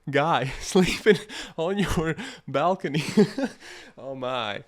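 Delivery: normal talking voice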